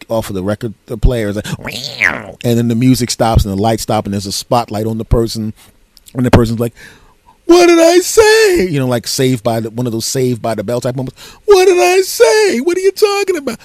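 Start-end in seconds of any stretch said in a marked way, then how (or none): 1.6-2.4 imitated record-scratch sound effect; vocal needle-scratch screech. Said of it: "they have that sound effects". 7.4-8.7 high, drawn-out sitcom shout; very high, loud comic disbelief; mock outburst. TV character stuns dance party. 11.4-13.6 very high, loud sitcom squawk; shrieked comic indignation, speeding up at the end; mock outburst. Repeats the sitcom moment.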